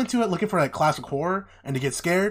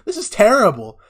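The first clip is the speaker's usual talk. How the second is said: high, loud scornful exclamation; mocking amusement; 7 semitones higher, 9 dB louder than usual. She sees her portrait, high mocking amusement. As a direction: imitate a derisive reaction, pitched up. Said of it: "she starts laughing".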